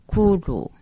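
骷髅 sounded as "ku-ru"; mantra pronunciation. Shown as a demonstration. Teacher showing how two written characters are read, pronunciation demo. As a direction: demonstrate 骷髅 as a two-syllable "kuru". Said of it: "巨乳两个字念成".

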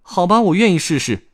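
put-on high, loud child voice; willing little boy. Boy accepts grandpa's offer, eager child voice.

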